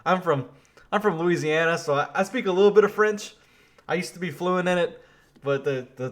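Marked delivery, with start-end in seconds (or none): none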